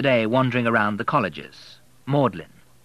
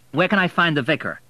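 louder, higher demand; 7 semitones higher, 5 dB louder than usual. A narrator's reading. William demanding the vicar from the church warden, louder. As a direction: press for an answer. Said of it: "demanded William".

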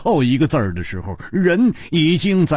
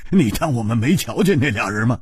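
laughing; 4 semitones lower than usual. Acted. Hu Tiehua asks if his friend thought they missed them, laughing. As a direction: chuckle leading into the question. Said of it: "胡铁花大笑道".

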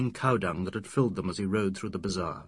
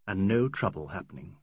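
ordinary delivery, nothing marked

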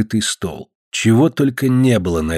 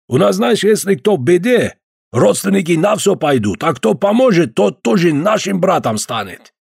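put-on Caucasian accent; 7 semitones higher than usual. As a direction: stretch each word with a heavy Caucasian accent. Georgian visitor voiced.

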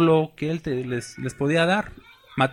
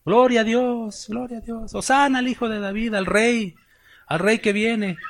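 cheering crowd; 9 semitones higher than usual. Retold or imitated. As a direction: cry out like a festive crowd. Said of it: "Ahí sí la gente".

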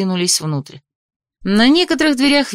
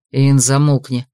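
growled; 7 semitones lower than usual. Acted. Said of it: "рыкнул Аршес".

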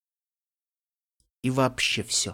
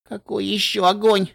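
whimpering; 8 semitones higher, 3 dB louder than usual. A narrator's reading. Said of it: "проскулила я".

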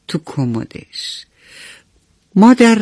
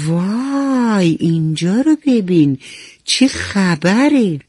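usual speaking voice

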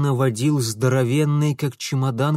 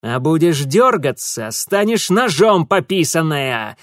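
barking; 2 semitones higher, 5 dB louder than usual. A narrator's reading. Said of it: "рявкнул Франт".

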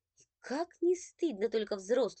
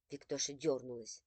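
ordinary delivery

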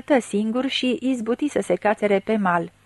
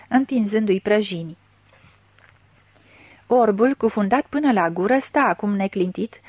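ordinary delivery

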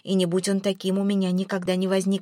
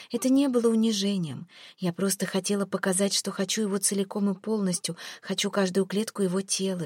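ordinary delivery

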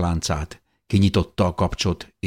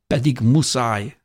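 higher, slow, understated sob; sobbing; 7 semitones higher, slower than usual. A narrator's reading. Beloved insists he must go, mildly sobbing. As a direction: plead tearfully, drawing it out.

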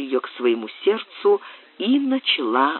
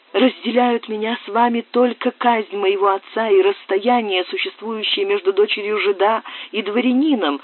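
голос Сюзанны; 7 semitones higher than usual. Acted. Сюзанна говорит о своей тайне.

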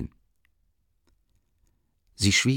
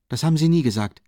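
sharp, hot-tempered; 3 semitones higher, 5 dB louder, faster than usual. A narrator's reading. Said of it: "antwortete scharf und jähzornig".

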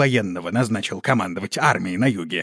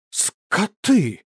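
high, sharp cry of outrage; indignant outburst; 9 semitones higher, 3 dB louder than usual. A narrator's reading. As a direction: spit it out in disgust. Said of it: "возмутилась королева".

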